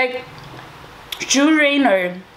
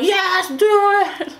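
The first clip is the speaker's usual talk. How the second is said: excited sing-song; 9 semitones higher, slower than usual. Retold or imitated. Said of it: "And I was like".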